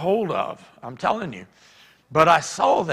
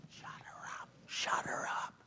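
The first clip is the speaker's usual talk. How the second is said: very soft whispered order; urgent whisper; 20 dB quieter than usual. Retold or imitated. Preacher privately urging the worship leader, whispering. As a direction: whisper it under cover of the service.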